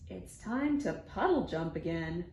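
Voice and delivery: ordinary delivery, nothing marked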